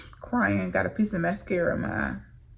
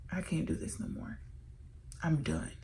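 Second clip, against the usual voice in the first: despairing whisper; 2 semitones lower, 12 dB quieter than usual. Retold or imitated. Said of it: "I said to myself".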